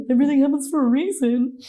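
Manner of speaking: lightly mocking preachy tone; mock platitude. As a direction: parrot the cliché back sarcastically. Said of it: "I hate it when someone says it".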